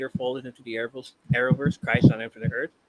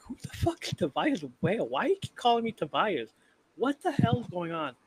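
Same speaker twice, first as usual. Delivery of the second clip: higher, indignant voice of an insulted stranger; mock offended troll; 8 semitones higher, 4 dB quieter than usual. Retelling a livestream, the troll asks who Tobias Whale is in a higher, indignant mock voice. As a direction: play the confused, offended guy.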